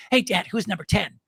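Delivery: lightly voiced little girl; small child's question to Dad. Little girl asking her dad about a player, lightly voiced child. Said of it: "My little girl says to me".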